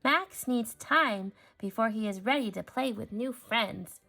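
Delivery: ordinary delivery, nothing marked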